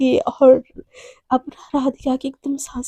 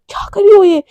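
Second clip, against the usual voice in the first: startled, higher and louder; shocked exclamation; 5 semitones higher, 6 dB louder than usual. Acted. Girlfriend suddenly kissed, crying out in shock. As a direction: caught off guard by a sudden kiss.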